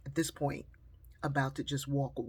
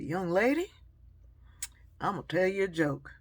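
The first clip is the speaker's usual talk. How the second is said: booming Southern man calling out; loud stranger's hail; 3 semitones higher, 8 dB louder than usual. Retold or imitated. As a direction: hail her loudly from across the sidewalk. Stranger's loud opening to joke.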